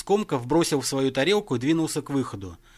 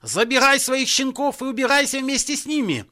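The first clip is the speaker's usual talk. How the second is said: high, loud sustained bellow; furious ejection; 11 semitones higher, 5 dB louder than usual. Acted. Father orders son and musicians out, furious bellow. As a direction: roar at them to get out. Father throws son's friends out.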